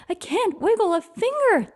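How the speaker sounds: high, storybook wail of distress. A narrator's reading. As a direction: whimper it out, stuck and helpless. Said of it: "the trumpeter wailed".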